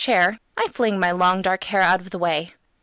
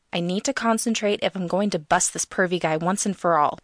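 usual speaking voice

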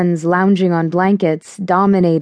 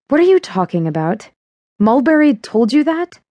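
shocked; 6 semitones higher than usual. A narrator's reading. Shocked disbelief at news.

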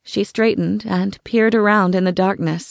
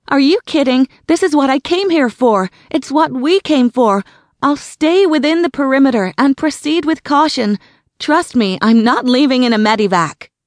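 higher, loud and determined; defiant insistence; 7 semitones higher, 5 dB louder than usual. A narrator's reading. Sarah insisting to Adam she will stay and proceed. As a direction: push back, refusing to leave. Defiant reply over radio.

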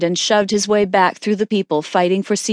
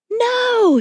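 shriek. A narrator's reading. Mother refusing the stranger's help, shrieking. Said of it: "she shrieked".

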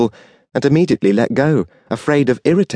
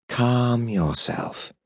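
slow, soft and soothing; calm her down gently and slowly; a slow, hushed angel soothing a weeping soul; 7 dB quieter, much slower than usual. A narrator's reading. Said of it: "he soothed".

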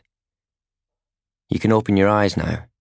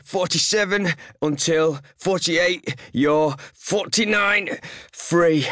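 straining through press-ups; 8 semitones higher than usual. Acted. Counting press-ups with inner thoughts.

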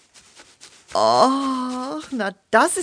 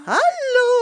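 high mock greeting; 5 dB louder than usual. Hen greets newcomer sweetly.

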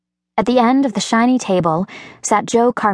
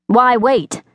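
sharp, higher indignant question; 5 semitones higher, 3 dB louder than usual. A narrator's reading. Paulding challenges his lawyer, indignant and sharp. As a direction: push back, offended at the delay. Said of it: "Paulding asked indignantly".

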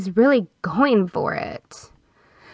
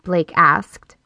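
plain reading voice

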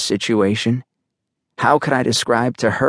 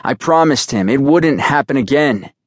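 higher, emphatic vow; insistent, raised-pitch promise; swearing a promise; 6 semitones higher, 3 dB louder than usual. A narrator's reading. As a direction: swear it firmly, pitch lifted. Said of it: "I swore".

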